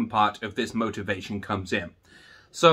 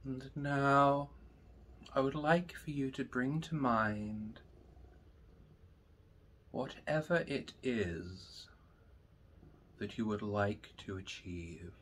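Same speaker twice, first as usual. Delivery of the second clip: guided meditation; 10 dB quieter, slower than usual. Acted. Teacher leading students through breathing, slow and soft. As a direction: guide them into calm, slowly.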